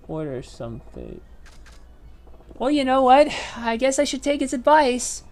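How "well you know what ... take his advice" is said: excited. Real, not acted. Streamer reacts to game choice.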